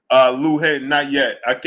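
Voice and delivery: usual speaking voice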